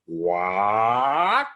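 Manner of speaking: long, rising "What?"; fans quoting a catchphrase. Retold or imitated. People greeting an actor with a show's line, exaggerated "What?". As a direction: stretch "What?" and slide upward theatrically. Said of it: "people who come up to me".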